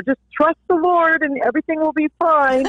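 high, breezy mock-reassuring platitude; singsong easy advice, imitated; glib reassurance. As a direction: toss it off far too easily, pitched high. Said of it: "it's so easy, Jensine, to say".

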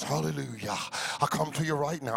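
mock pompous preacher tone, dropped low. Pastor mocking the put-on preacher voice, hammed-up. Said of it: "you know the preacher voice".

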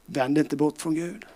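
ordinary delivery

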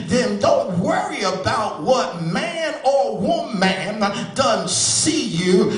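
loud, ringing preaching over the organ; full-force preaching. Acted. Preacher lifting the congregation, in full-force preaching. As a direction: preach it out to the whole church.

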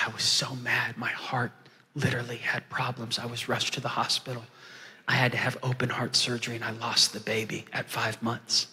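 very soft, low retelling of loss; sombre testimony. Retold or imitated. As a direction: keep her grief hushed and restrained. Retells her loss of baby and husband.

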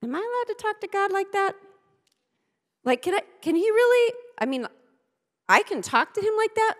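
nervous questioner. Retold or imitated. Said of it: "others of you are like".

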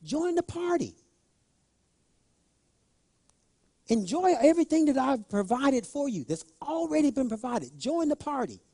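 high, soft, gentle plea from a father; pleading father. Retold or imitated. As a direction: coax the son in softly, as a pleading father. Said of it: "He pleaded".

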